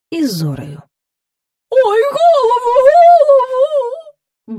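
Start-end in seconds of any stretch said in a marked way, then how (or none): 1.7-4.6 very high, loud, drawn-out wail; pleading. A narrator's reading. Cow begging as courtiers tug at her horn, wailing. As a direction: howl it out, stretching the last word. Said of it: "благала корова".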